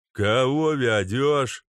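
slow, mangled yokel guard voice; mangled words. Acted. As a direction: slur the words like a thick guard. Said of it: "сильно коверкая слова".

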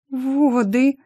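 high, trembling half-whisper; frightened, trembly high voice. A narrator's reading. Pregnant secretary tells her colleague, trembling whisper. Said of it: "прошептала дрожащим голосом".

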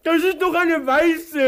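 high, loud outraged protest; loud indignant complaint. Acted. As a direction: protest the wrong carrot.

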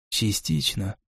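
muffled voice from under a helmet; quiet, muffled behind a visor; echoing voice inside helmet. Acted. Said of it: "голос из-под шлема".

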